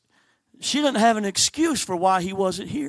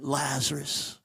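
soft, low, endearing Lazarus, Lazarus; softly calling a dead friend by name; tender call; 6 semitones lower, 7 dB quieter, slower than usual. Acted. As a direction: call him out gently like a loved friend. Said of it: "calls forth with a term of endearment".

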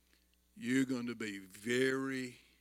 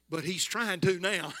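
higher and louder, through a laugh; laughing reply; 6 semitones higher, 6 dB louder than usual. Retold or imitated. Proud father joking about his son, laughing. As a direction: chuckle out the dad's comeback.

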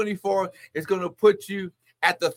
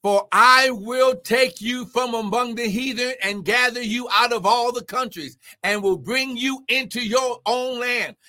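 high, rolling preacher's cadence; proclaimed scripture; 7 semitones higher, 3 dB louder than usual. Real, not acted. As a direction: declaim the verse in one driving sweep.

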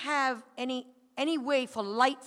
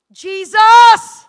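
desperate full shout of the name; anguished shout of Jesus; crying out Jesus; 9 semitones higher, 24 dB louder than usual. Retold or imitated. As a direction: cry out to him with everything. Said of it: "I just cried out".

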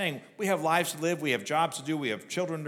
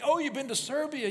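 amazed question; 12 semitones higher than usual. Retold or imitated. Retold store chat, woman amazed.

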